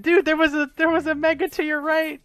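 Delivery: high, loud disbelieving shout; exasperated outburst. Real, not acted. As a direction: call out the blunder in disbelief. Coach exasperated at wrong move.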